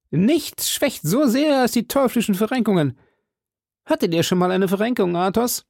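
high, animated character voice; hearty musketeer host. Acted. Host complains of sprains to friend, high voice. Porthos voiced in story dialogue.

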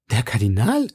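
startled question with a rising end; startled exclamation. A narrator's reading. Wife hears of the Cardinal, startled. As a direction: cry out in mild surprise. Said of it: "rief Madame Bonacieux".

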